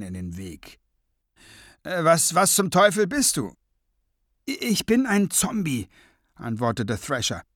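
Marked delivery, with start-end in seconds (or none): 1.8-3.5 stammering, higher and louder alarm; high-pitched, loud disbelief; startled wizard. A narrator's reading. 4.5-5.9 high, hesitant character voice; nervous high-voiced sidekick; stammering zombie servant. A narrator's reading. Thrasher stammers his introduction.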